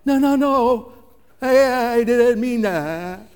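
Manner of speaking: high, loud, drawn-out comic panic; panicked retraction. Acted. Speaker regretting a hurtful remark, hammed-up panicked retraction. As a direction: desperately try to pull the words back, hammed up.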